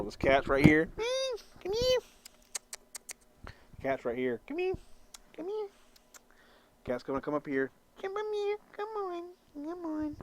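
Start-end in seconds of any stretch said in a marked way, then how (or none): 1.6-2.0 squeaky high coaxing call to the cat. Real, not acted. Calling the cat over. 4.5-5.7 squeaky high coaxing call to the cat. Real, not acted. Calling the cat over. 8.0-10.2 squeaky high coaxing call to the cat. Real, not acted. Calling the cat over.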